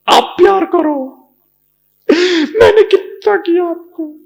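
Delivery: high, loud fake sob; mock crying. Complaining spouse wailing about love, mock sobbing. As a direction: wail about how much you gave.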